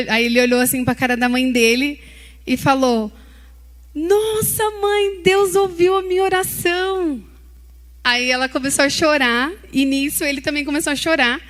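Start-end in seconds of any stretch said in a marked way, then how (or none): 3.9-7.2 high and louder, slow and awestruck; a boy stunned that his prayer came true; amazed child. Retold or imitated. Boy telling his mother God heard him, high, loud amazement.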